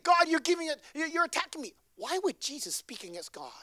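ordinary delivery

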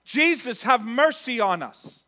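loud, high drawn-out plea; crying out. Acted. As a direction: cry it out, begging for mercy. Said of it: "No, they cried out".

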